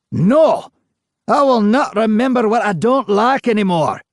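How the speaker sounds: high, loud shout. A narrator's reading. Ghost shouting at the persistent interviewer. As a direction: yell it in refusal. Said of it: "the ghost shouted".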